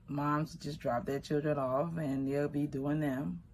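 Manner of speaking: plain reading voice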